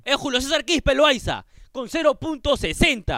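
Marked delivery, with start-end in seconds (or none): none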